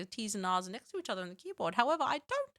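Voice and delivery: put-on reading a poem in a wobbly British accent; attempted British accent. Streamer reading a poem aloud to chat, attempted British accent. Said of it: "I don't know what that accent was".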